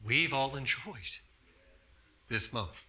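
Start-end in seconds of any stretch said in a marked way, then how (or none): none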